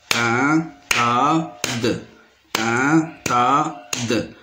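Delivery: PINTADE slowly split as pin-ta-de; three separate beats in PINTADE, final de voiced; teacher counting out the syllables of PINTADE. Shown as a demonstration. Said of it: "se compose de trois syllabes".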